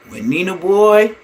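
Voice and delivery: ordinary talk, nothing marked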